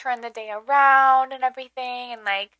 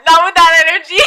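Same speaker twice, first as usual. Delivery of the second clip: laughing tease; 4 semitones higher, 13 dB louder than usual. Real, not acted. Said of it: "teasing, laughing".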